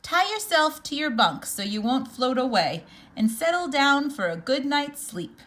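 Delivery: usual speaking voice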